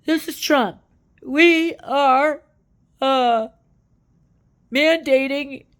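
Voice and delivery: hammed-up Trump bragging. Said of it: "I can't even do the Trump voice".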